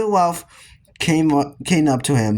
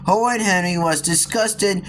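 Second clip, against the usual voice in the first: plain reading voice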